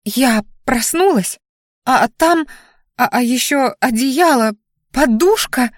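задыхающаяся паника. A narrator's reading. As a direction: stammer it out, fighting for air. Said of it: "хватала ртом воздух шатенка". (испуганно, сбивчиво, хватая ртом воздух)

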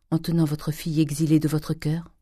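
ordinary delivery

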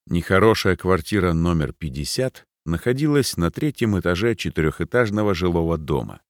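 ordinary delivery, nothing marked

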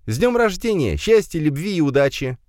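put-on high-pitched girlish well-wishing; girl congratulating. A narrator's reading. Alisa wishes Firs happy birthday, high girlish voice. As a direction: wish a friend well in a high female voice.

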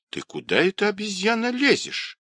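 high-pitched indignant cry, no real volume; old woman's cry. Acted. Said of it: "крикнула старуха".

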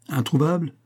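reprise étonnée, ton montant et plus aigu; question incrédule. A narrator's reading. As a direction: relever le mot comme une question incrédule. Elle reprend le mot en question. (incrédule, voix qui monte)

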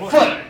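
فعل as one syllable, fu'l; damma then sukun. Shown as a demonstration. Teacher ends the pattern list, damma then sukun. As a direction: say فعل with u, no vowel after ayn. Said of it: "نضم الفاء".

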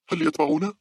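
high-pitched, urgent acted question; urgent question on the run. A narrator's reading. Fleeing girl asks her friend, urgent and high. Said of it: "سألت صديقتها وهي تقترب بسرعة".

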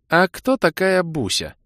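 a hint of a high woman's voice; lightly voiced girl, slow; feigned neutral voice. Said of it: "Внешне нейтральным голосом".

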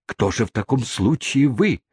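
rising, eager suitor; ardent suitor. Acted. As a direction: press the question eagerly. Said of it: "возразил мистер Тапман".

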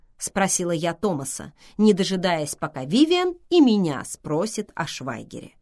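usual speaking voice

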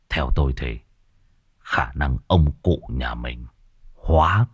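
put-on low, soft, hesitant old man; grave old master. Master hesitantly warns the couple, low and grave. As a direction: weigh each word before the bad news.

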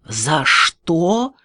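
incredulous, rising angry question; acted rage on a climbing question; furious echo. A narrator's reading. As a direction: throw the question back in outrage. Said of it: "заорал в бешенстве".

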